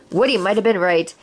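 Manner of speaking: plain reading voice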